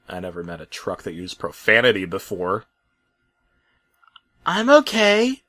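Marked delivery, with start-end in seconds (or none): 4.4-5.4 smiling Wendy. Acted. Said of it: "Wendy smiled".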